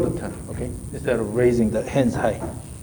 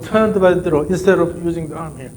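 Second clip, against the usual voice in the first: ordinary talk, nothing marked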